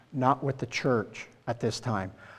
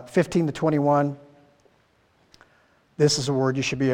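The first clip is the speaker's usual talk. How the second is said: normal talking voice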